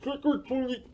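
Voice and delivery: high, soft, muffled through a mouthful; mouth full of candy. A narrator's reading. Morris says trick or treat through a mouthful of candy. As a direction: garble it past a mouthful of sweets. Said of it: "mouth was full of candy".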